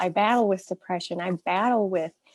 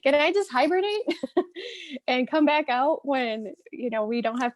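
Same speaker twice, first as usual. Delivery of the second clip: pleading to hibernate; 6 semitones higher than usual. Retold or imitated.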